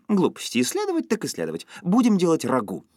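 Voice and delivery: high, energetic wifely voice; lively, decisive, pitched high; brisk dismissal. A narrator's reading. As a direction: sweep his doubts aside eagerly. Wife cheerfully overrides husband's doubts.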